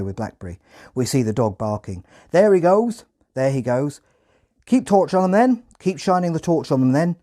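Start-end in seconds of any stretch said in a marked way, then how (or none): 2.3-3.1 high, loud Hampshire farmer shout; loud rural Hampshire accent. Retold or imitated. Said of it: "in a thick Hampshire accent". 4.7-5.6 high, loud Hampshire farmer shout; loud rural Hampshire accent. Retold or imitated. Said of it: "in a thick Hampshire accent".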